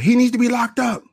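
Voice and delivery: high, shrill-free but lifted mom voice, demanding; mock the mother's high demand; imitated mother. Voicing the boy's mother.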